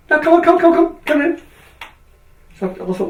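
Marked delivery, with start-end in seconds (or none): 0.1-1.4 high, loud, hurrying imitation; insistent urging. Surgeon overruling a reluctant patient at the bedside, insistent urging. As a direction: chivvy impatiently, overriding any objection.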